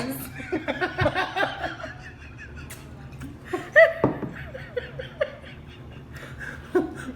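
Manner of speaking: long high breathy laugh; helpless fit of laughter; laughing hard. Real, not acted. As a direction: crack up at the joke. Laughing at the mock mantra.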